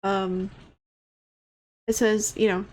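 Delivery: usual speaking voice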